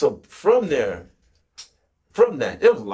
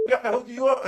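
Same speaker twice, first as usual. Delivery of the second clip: mock boast; 13 semitones higher than usual. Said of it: "I'm like".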